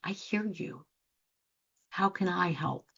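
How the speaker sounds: softly spoken reassurance; gentle reassurance. Shown as a demonstration. Coach showing calm words to someone asking for help. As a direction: say it softly, just listening. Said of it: "someone to say".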